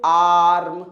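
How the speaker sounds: "आर्म" held long on a low "aa"; drawn-out long vowel. Shown as a demonstration. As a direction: stretch the "aa" in "आर्म" for students to repeat. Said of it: "हम word को खींच रहे हैं". ("आर्म" का "आ" खींचकर)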